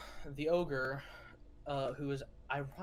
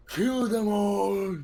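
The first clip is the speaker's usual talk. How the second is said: slow, loud bellowing ogre; ogre war cry; 6 semitones higher, 11 dB louder, much slower than usual. Acted. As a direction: roar it like a brute charging. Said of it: "as the ogre goes".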